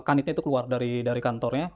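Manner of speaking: ordinary talk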